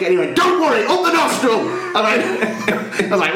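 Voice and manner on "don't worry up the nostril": high-pitched imitation of a shouting helper; hammed-up urgent reassurance; mock loud instruction. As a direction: mimic an overbearing drill-style order. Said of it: "he was getting louder".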